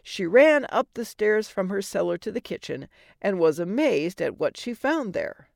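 ordinary delivery, nothing marked

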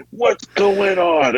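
high, slow, bewildered cry; mock panicked confusion. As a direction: act out rising paranoia. Retells paranoid confusion.

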